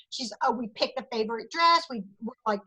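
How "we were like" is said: usual speaking voice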